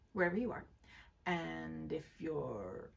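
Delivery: usual speaking voice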